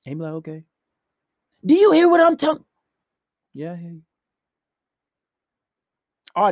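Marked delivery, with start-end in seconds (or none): 0.3-0.6 very soft, unbothered acknowledgement; barely voiced, indifferent okay; calm. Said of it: "calm, cool, and collective about it". 1.6-2.6 loud, high-pitched demand; angry yelling. Retold or imitated. Girlfriend demanding he listen, loud angry yell. 3.5-4.0 very soft, unbothered reply; calm. Retold or imitated. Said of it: "calm, cool, and collective about it".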